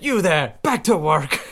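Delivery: high, mock-bossy Scrooge call from a window; hammed-up Scrooge shout. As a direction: bark a light order like Scrooge. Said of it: "Scrooge at the end of Christmas Carol".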